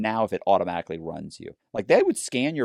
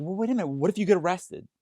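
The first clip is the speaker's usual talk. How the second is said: worried wife; 9 semitones higher than usual. Retold or imitated. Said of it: "she'd go".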